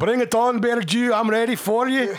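high-pitched mock Scottish challenge; hammed-up Scottish fighting talk; put-on Scottish accent. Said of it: "in that accent".

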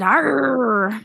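lightly voiced pirate growl. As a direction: toss off a quick pirate "Arr". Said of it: "You sounded like a pirate".

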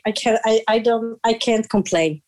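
usual speaking voice

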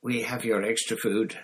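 ordinary delivery, nothing marked

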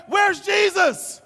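loud, high, indignant outcry; acting out desperate, accusing shouts; frantic shouted demands. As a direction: yell over a storm, demanding help. Voicing frantic disciples in the storm.

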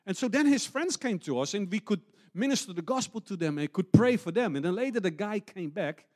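loud, high, driving sermon delivery; full-force preaching. Acted. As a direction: preach it out to the whole hall.

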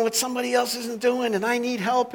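a lightly voiced harried hostess; mock bossy Martha. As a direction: play Martha nagging her sister to get working. Martha's complaint voiced again.

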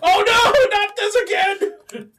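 mock wail. Cat card played, mock despair.

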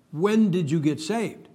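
slow, every word spaced out; slow, deliberate re-ask. Retold or imitated. Preacher re-asks the lady slowly after her odd answer. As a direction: spell it out slowly after being misheard. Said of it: "I said, "Okay." I said".

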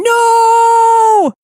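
high, loud mock scream. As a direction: shriek "No!" in panic. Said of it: "just going".

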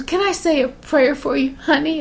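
lightly voiced sweet old churchgoer; shaky old lady. Narrator imitates the old lady offering a prayer in a mildly shaky voice. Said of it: "so she goes".

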